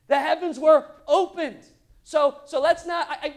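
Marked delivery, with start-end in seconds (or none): 0.1-1.6 loud, high, awestruck proclamation; big dramatic verse delivery. Acted. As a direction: declare it as a tremendous event. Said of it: "Pretty dramatic event".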